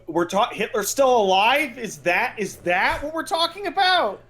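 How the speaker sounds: normal talking voice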